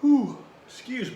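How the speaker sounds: a big real yawn breaking through. Real, not acted. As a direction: yawn out the words before apologizing. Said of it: "I apologize for continuing to yawn".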